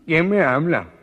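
high, loud, slow alarmed shout; the nasal man shouts at the unseen voice; startled nasal man. Acted. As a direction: yell it in a panic. Gangoso shouts.

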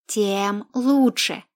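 ordinary delivery, nothing marked

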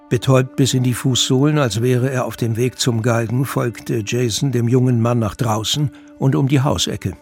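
ordinary talk, nothing marked

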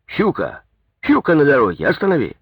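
high, urgent cry of alarm; shouting to driver. A narrator's reading. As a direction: call out sharply to make the driver stop. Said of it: "крикнул шофёру". (громкий высокий крик шофёру)

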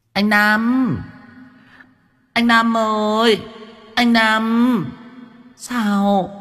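high, sing-song camp falsetto; shrill campy voice. A narrator's reading. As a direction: call out coyly in a high camp voice. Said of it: "giọng của con Diễm Hường nheo nhéo".

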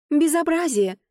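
the lady bursts out in outrage; indignant. Acted. The lady outraged at the girl's rudeness, indignant. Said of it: "возмущалась леди".

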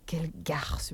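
soft, low hissed insult; barely voiced murmur of contempt. A narrator's reading. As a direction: mutter it under her breath. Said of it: "murmura Elsa".

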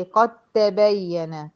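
slow قد تبين with the د vanishing into a doubled ت; slow, firm tajweed demo; merged قد تبين. Shown as a demonstration. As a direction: press the ق straight onto ت. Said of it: "هنا بدخل من القاف على الت".

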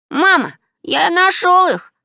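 high, loud, stretched-out call; gleeful boy's cry; overjoyed child. Acted. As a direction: shout the happy news to mom. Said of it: "обрадовался Маркус".

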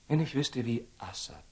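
ordinary talk

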